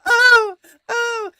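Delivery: loud, high wailing "oh"; imitated hollering. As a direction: holler helplessly in a mock high voice. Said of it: "she's just hollering out".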